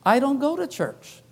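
light, sweet little woman's voice; sweet petite voice. Retold or imitated. A hint of a sweet lady at the door, turning the preacher away. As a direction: voice her dainty and polite. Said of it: "she said in a sweet, petite voice".

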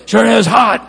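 loud, gruff neighbor's answer; grumpy reply. Retold or imitated. Sour neighbor answering the pastor's greeting, loud and gruff. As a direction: grumble back at the greeting.

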